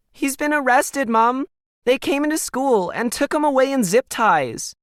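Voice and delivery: a frightened daughter blurting bad news to her mom; upset teen daughter. A narrator's reading. Emily voiced in read story.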